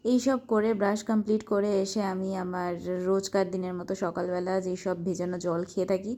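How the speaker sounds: ordinary talk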